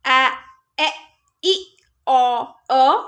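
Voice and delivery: each short vowel sound said slowly and clearly, one by one. Shown as a demonstration. Teacher modelling short vowels for parents, clear and deliberate. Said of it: "short vowel sound पहले आए".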